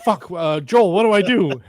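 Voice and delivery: mock panic, pitch and volume rising; panicked plea. [name] under fire asks Joel for help, panicked.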